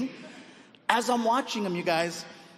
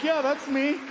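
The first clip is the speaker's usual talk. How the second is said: outwardly composed; 7 semitones higher than usual. Acted. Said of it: "Outside, I'm like".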